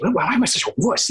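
high-pitched mock self-scolding; arguing with oneself. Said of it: "arguing with myself".

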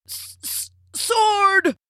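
strangled gasps breaking into a high cry; choking stutter, then a high burst; choked out. A narrator's reading. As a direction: force the word out as if being throttled. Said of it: "choked out the prince".

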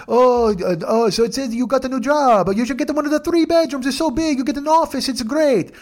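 high-pitched Italian building-manager impression; excited, sing-out Italian character voice; Mario. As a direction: pitch it way up, gushing a sales offer. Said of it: "I'll just do Mario's voice".

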